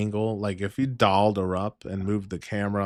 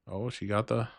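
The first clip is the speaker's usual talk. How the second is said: ordinary talk, nothing marked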